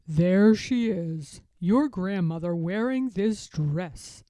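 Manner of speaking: put-on a gentle old bear showing an old photo; Grandfather Bear. Grandfather Bear showing his grandson a photo, fond old bear voice.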